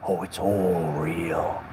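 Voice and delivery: slow, low mock believer; mock deep voice. Mocking people who trust the TV, slow low voice. Mocks gullible viewer.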